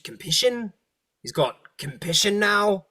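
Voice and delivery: compission said for compassion, puzzled; high puzzled compission; mispronounced word. Acted. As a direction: stumble over compission. Devil mangles word compassion.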